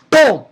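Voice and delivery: loud, explosive sound effect; big exploding "boom"; imitated boom. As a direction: punch out a sudden high blast.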